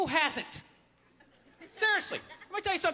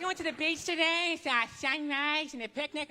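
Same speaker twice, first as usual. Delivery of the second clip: lightly mocked nice-person storyteller. Comic mimicking a nice person's boring beach story, nice-person voice. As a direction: recount a dull day as a nice person would. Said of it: "Nice person tells you a story".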